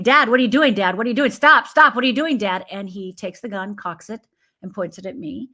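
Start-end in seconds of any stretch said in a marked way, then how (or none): 0.0-2.6 put-on loud, urgent child's pleading; raised, panicked retelling of a scream; retold screaming. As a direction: cry out to make Dad stop. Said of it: "I start screaming".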